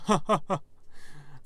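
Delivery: loud, high villain cackle; gloating monster laugh after a threat; villainous laugh. Acted. Moose head gloating over the player, evil laugh.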